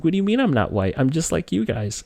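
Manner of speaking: put-on high-pitched, wounded child's protest; imitating a tearful kid's bewildered appeal; teary-eyed child. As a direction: plead as a hurt little kid, mildly acted. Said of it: "looked at my teacher all teary-eyed".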